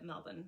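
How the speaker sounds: Melbourne as MEL-bun; Australian pronunciation. Shown as a demonstration. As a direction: say Melbourne the local way, ending in a short "bun". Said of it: "all of the Australians pronounce it".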